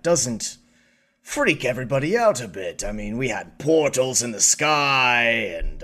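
oily politician smoothing things over, rising at times; a slick statesman spinning the story; smarmy Chancellor. Acted. Chancellor explains the spin.

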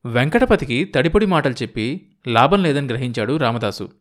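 ordinary delivery

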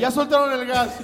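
mock drunk uncle.

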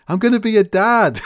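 high, loud mock proud call; proud announcement. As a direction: announce it with a big proud shout. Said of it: "he goes, like".

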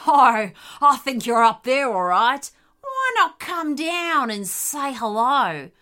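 loud, taunting dingo villain; menacing lead dingo. A narrator's reading. Lead dingo luring the animals down, loud and menacing. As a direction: coax your prey down, loudly.

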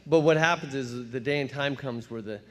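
normal talking voice

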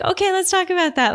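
high, cheerful invitation; bright therapist voice. Retold or imitated. A therapist brightly inviting her patient to talk it through, pitched high. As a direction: sound upbeat and encouraging to the patient. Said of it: "And I was like".

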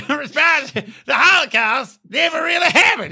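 hammed-up high yelling imitation. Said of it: "He's like, he sounds like".

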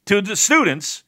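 ordinary talk, nothing marked

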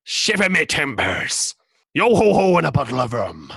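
slow, hammed-up pirate growl. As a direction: play a cartoon pirate captain. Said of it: "You even sound like a pirate".

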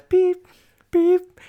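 short, high-pitched vocal beep; imitated beep, high and sharp; mimicked beep. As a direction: squeal out a loud electronic-style beep. Imitating beeps while retelling.

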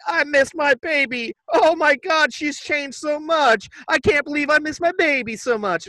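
hammed-up old Italian mama lament; old Italian lady. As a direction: overact the regretful mother, pitched high. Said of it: "this lady is an old Italian lady now".

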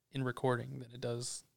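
ordinary delivery

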